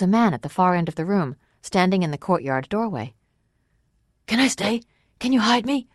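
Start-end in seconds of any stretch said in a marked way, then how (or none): 4.3-5.9 high, urgent plea for shelter; frightened plea. A narrator's reading. Fugitive begging the Waylord for a hiding place, frightened plea. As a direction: beg to be taken in, pitched high.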